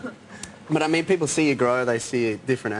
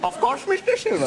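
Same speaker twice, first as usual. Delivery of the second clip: very high, silly squeaky voice; squeaky stranger. Acted. Stranger answering Sterling in a very high squeak. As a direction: squeak it out like a cartoon.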